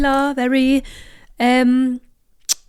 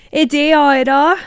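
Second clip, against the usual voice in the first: louder, higher southern drawl; playfully hammed-up southern twang; southern accent; 5 semitones higher, 5 dB louder, much slower than usual. Shown as a demonstration. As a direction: lean hard into the southern accent. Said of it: "my southern accent all of a sudden".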